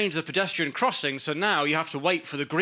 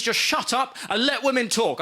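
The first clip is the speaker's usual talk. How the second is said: firm, higher-pitched read-out command; stern tweet; 5 semitones higher than usual. Retold or imitated. Comic reads out a tweet telling men off, stern. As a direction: lay it down like a firm order. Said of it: "this tweet and it said".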